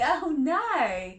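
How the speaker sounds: usual speaking voice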